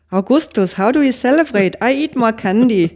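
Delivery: put-on high, loud German-accented English film quote; mock German accent. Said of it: "meget tysk accent i mit engelske".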